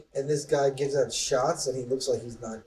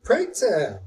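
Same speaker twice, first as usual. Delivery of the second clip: mock outrage; 7 semitones higher, 8 dB louder, slower than usual. Mock formal indignant question.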